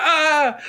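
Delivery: high-pitched comic scream, laughing; mock electrocution scream. Imagining someone electrocuted in the shower, mock scream. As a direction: let out a high mock shriek.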